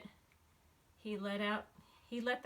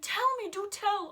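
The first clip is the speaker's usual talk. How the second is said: much louder, higher glad shout; joyful cry; 11 semitones higher, 10 dB louder than usual. Acted. Horton finding the Whos at last, joyful cry. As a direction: cry out in relief. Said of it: "cried the elephant".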